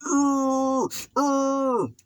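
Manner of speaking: high strained mock grunts; weak grunts. Scrawny young inmate's real fight noises, strained grunts. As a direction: squeak out feeble grunts. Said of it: "but in reality".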